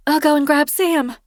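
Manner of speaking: high, sheepish confession voice; Kirsten sheepish. A narrator's reading. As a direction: voice her high and apologetic.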